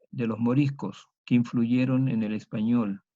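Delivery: usual speaking voice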